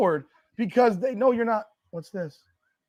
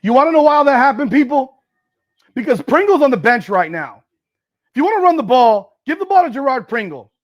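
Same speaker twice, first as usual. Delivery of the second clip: loud, raised-pitch blaming; angry rant; 4 semitones higher, 8 dB louder than usual. Real, not acted. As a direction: shout the grievance at the coaches. Angry rant after interception.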